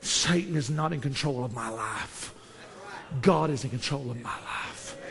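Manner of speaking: low, soft, measured declaration; hushed conviction. Real, not acted. Preacher affirming who controls his life, low and hushed. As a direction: state it quietly and firmly after the shouting.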